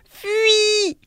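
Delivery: high, urgent cry to flee; shouted warning. A narrator's reading. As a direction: yell it up high to wake the sleeper. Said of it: "cria le lièvre".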